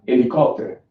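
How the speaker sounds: HELICOPTER starting straight on the E; silent H. Shown as a demonstration. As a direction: say "helicopter" as "elicopter". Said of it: "the H is silent".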